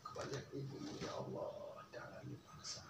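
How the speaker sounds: near-whisper, softly pleading; hushed pleading. Retold or imitated. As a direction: breathe the plea under your voice. Maid pleading quietly in story. (bisikan memohon pelan)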